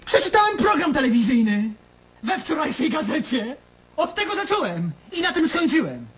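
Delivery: mock the television programme with sarcasm; sarcastic. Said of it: "z takim przekąsem".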